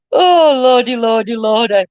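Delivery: wheezing it out mid-laugh; tickled exclamation. Real, not acted. Recovering from a laughing fit.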